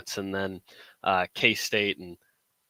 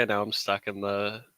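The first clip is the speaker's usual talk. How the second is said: ordinary talk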